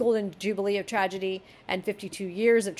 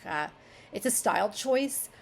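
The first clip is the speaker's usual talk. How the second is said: ordinary talk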